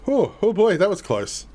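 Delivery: high, loud startled gasp of relief. Real, not acted. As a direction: react to a narrow escape. Near miss in game.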